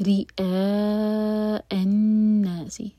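رِئَاءَ النَّاسِ slowly, clean stops on each ء; cut-off hamza. Shown as a demonstration. Teacher recites رِئَاءَ النَّاسِ for a student, slow. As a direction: stop crisply on the ء in رِئَاءَ. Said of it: "حمزہ پر cut off sound".

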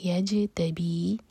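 یجتبی slow, final بی held two counts; two-count madd. Shown as a demonstration. Teacher repeats the word with a two-count ending. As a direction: stretch بی in یجتبی for two beats. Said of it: "بی دو حرکہ لمبا".

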